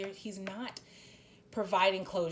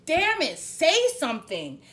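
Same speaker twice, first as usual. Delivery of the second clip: slow, louder exasperated outburst; real impatient outburst, louder; irritated; 6 semitones higher, 9 dB louder than usual. Real, not acted. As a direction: demand it, fed up. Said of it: "I'm getting irritated".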